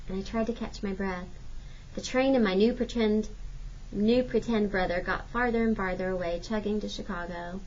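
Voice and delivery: ordinary delivery, nothing marked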